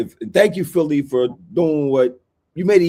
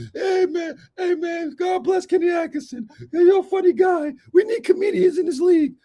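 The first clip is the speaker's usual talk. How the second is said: mocking taunt. Real, not acted. Mocking a rival coach.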